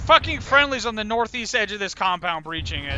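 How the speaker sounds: loud urgent warning; shouting. Real, not acted. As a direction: bellow it over gunfire. Yelling about friendlies under fire.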